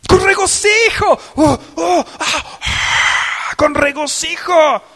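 high, loud, jubilant cry of joy; joyful shout. Shown as a demonstration. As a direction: burst out in rejoicing, loud and high. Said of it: "así es como yo manifiesto el regocijo". (grito de júbilo, alto y fuerte)

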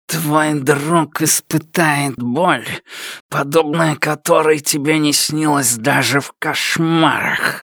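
slow menacing creature voice sinking to a whisper; creeping nightmare whisper. Acted. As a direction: order it like a nightmare breathing close. The shadow threatens.

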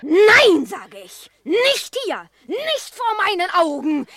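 loud, high, forbidding roar; furious shout. Acted. Tiger shouts at cat and dog courting before him, furious. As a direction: slam the words down in rage.

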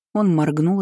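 plain reading voice

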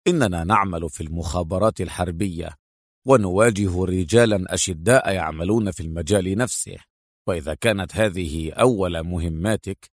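low, cool male officer voice; deep, dismissive warning; coldly. A narrator's reading. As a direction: lecture a rookie with deep, icy detachment. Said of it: "وقال ببرود".

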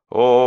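loud, long-held echoing vowels; rolling echo. A narrator's reading. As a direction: let the syllables roll out long and loud. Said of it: "сильные и протяжные раскаты эха".

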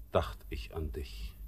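leises Flüstern, fast tonlos; geflüstert. Acted. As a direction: ganz leise hingehaucht. Intimate confession. (Flüstern)

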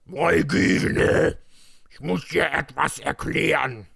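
hammed-up furious character voice; very angry. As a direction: force it out in mock rage. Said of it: "sagte Shadow sehr wütend".

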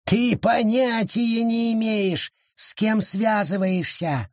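high, slow contemptuous creature voice; sneering forest creature; scornful snort. A narrator's reading. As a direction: scoff at her ignorance. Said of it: "фыркнул опоссум".

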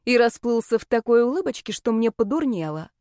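usual speaking voice

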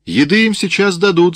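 high, loud, impatient call; sergeant's shout. A narrator's reading. Sergeant promising food to hungry children, shouting. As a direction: shout it over their complaints. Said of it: "закричал сержант".

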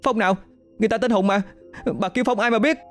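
high, soft protest read as a shout; mild mock cry out. A narrator's reading. Hân yelling back at the woman outside, lightly voiced. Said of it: "Hân gào lên".

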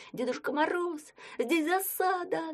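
put-on high, slow boy's call; boy's cry. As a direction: cry out a warning, high-pitched. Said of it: "крикнуть".

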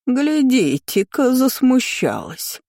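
high, slow, taunting drawl; nasty drawl. A narrator's reading. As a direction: tease in a stretched-out high voice. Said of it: "противно протянула тётка". (противно, нараспев)

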